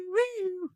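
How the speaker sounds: mock siren wail, woo sung way up high; French siren imitation. Shown as a demonstration. Mocking the French cop car siren for the guys, high siren wail. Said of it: "it's got that stupid woo".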